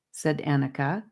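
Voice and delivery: plain reading voice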